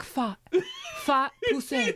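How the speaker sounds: slow, deliberate mock French name; put-on French accent. Woman retelling her introduction to dudes, put-on French accent. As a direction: give the name a French twist. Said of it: "I used to try to do a French accent".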